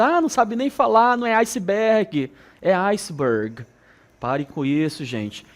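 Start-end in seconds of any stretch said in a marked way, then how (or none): 0.0-3.7 louder, higher teasing; mock a classmate. Classmate teasing over pronunciation, higher mocking voice. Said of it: "aí o outro já fica rindo".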